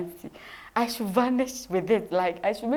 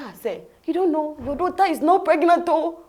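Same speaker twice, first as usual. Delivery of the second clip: cheerful protest; 7 semitones higher, 6 dB louder than usual. Retold or imitated. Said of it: "I said".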